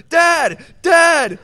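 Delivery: put-on loud kid yelling for dad. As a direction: shout across the yard.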